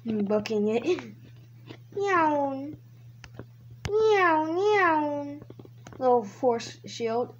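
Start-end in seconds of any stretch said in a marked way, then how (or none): 2.0-2.7 drawn-out "neeow" whoosh; imitated zooming noise. Player booking it from cops, drawn-out zoom noise. 3.9-5.4 high, drawn-out double whoosh; imitated zooming noise. Player dodging traffic, high swooping zoom noises.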